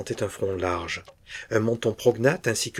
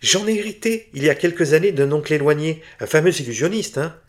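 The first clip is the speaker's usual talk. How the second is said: high, loud, gushing relief; a dealer giddy to escape losing his soul; eager relief; 7 semitones higher, 8 dB louder than usual. Acted. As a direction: rush to seal the deal.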